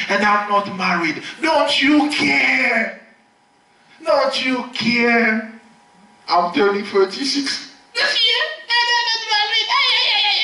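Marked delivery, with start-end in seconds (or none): 1.4-5.5 high, drawn-out plea; slow high mock plea; pleading complaint. Preacher mimics complaint to God. 6.3-9.7 much higher put-on woman's voice; high voiced mock lament; mock complaining woman. 9.7-10.4 high ay ay ay wailing; hammed-up lament; mock wail. Mimicked lament.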